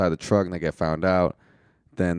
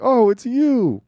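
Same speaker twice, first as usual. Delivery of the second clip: much higher, louder mock surprise; a hammed-up surprised "oh, it's you"; 7 dB louder, slower than usual. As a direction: jump up high in fake shock at the reveal. Retelling a comic surprise reaction.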